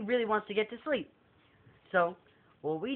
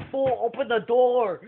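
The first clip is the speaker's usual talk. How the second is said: a louder, higher mock-angry demand; angry yell; 4 semitones higher, 7 dB louder than usual. Furious guest yelling at the pranksters' door, loud. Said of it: "He's like".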